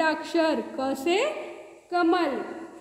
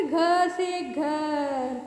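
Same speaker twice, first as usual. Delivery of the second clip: घ से घर stretched very slowly; teacher spelling out a word very slowly; very slow recital; much slower than usual. Shown as a demonstration. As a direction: draw out each word for the class. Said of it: "teacher demonstrating the letter".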